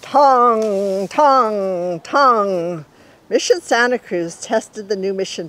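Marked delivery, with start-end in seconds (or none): 0.0-2.9 ringing bell tongs, loud and drawn out; booming bell sounds; bell imitation. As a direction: chime each tong like a big mission bell.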